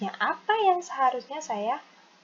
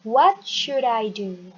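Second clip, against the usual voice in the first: slow, louder, clear falling question; slow model reading; 6 dB louder, slower than usual. Shown as a demonstration. Teacher modelling for students to repeat, slow. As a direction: drill it slowly for the class. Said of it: "listen and repeat after me".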